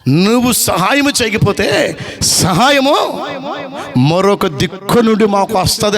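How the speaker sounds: slow, drawn-out, ringing warning; stern warning. Acted. Mordecai warns Esther help will come from elsewhere, slow stern warning. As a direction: stretch each word out like a proclamation.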